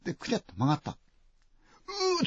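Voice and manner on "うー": high strained groan as he staggers; old man's groan. Acted. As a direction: groan as if stabbed. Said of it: "っつっておじいちゃんよろけた".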